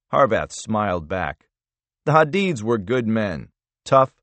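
usual speaking voice

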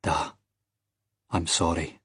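soft, low apology; hushed, grieving regret; anguished. A narrator's reading. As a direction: murmur it to a lost father. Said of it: "he thought in anguish".

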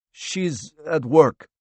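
slow, high-pitched character reply; lightly voiced cornered man; reluctant answer. A narrator's reading. Frightened man answers intruder.

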